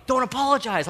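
high, very loud exasperated outburst; imitated yelling. Wife shutting down husband's apologies, imitated yelling. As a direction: yell it high, like a fed-up partner. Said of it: "She just kept yelling".

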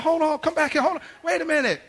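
high, loud pleading shouts; urgent calling back. Retold or imitated. Dad tries to stop son leaving, high urgent calls. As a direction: act out dad hollering to halt it all.

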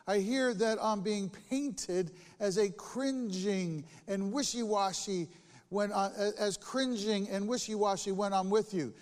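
scripture reading. Acted. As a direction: read the letter with weight. Reading Paul's letter aloud.

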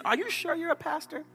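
high-pitched imitation of a sceptical woman; doubting. Young woman questioning the joking pastor, imitated high disbelief.

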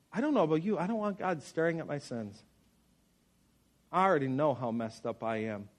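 ordinary talk, nothing marked

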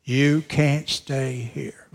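"You can't stay here" at half pace; measured and weighty, each word drawn out; slow, deliberate repeat. Real, not acted. Line repeated slowly for weight.

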